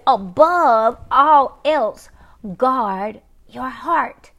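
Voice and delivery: "Above all else, guard your heart" very slow, word by word; emphatic scripture reading. Real, not acted. Preacher drives the verse home, slow emphasis on "Above all else, guard your heart".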